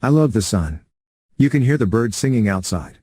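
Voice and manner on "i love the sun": ordinary delivery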